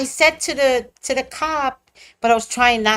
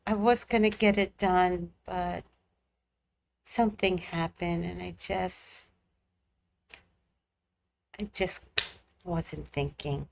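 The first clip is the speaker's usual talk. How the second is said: much softer, subdued confession; quiet apology; 3 semitones lower, 12 dB quieter than usual. Retold or imitated. Telling the cop why she failed, quiet and sheepish. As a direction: mumble it like you're ashamed. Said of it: "I said".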